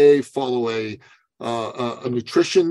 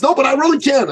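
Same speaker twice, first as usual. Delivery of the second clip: mock insistence pitched much higher and louder; insistent client; 12 semitones higher, 4 dB louder, faster than usual. Client insisting to his coach, high and louder. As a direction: dig in like the stubborn client.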